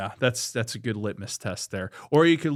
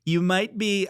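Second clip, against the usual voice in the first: high, slow put-on Trump voice; hammed-up Trump bit; Trump impression; 9 semitones higher, 5 dB louder, slower than usual. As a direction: drag it out like a mock Trump. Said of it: "I do the Trump stuff".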